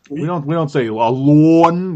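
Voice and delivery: put-on slow, lightly done Minnesota accent; drawn-out Minnesota accent, slightly hammy; Kurt Russell Minnesota accent. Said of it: "Kurt Russell in the movie Miracle".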